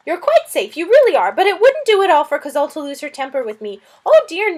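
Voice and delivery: high, loud, fretful lizard voice; a flustered little lizard fretting over a guest; fussy lizard. Acted. Lizard Suz fussing about the stranger, high and loud.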